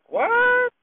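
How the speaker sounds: a startled cry of disbelief; stunned disbelief. Retold or imitated. Lady stunned by his offer.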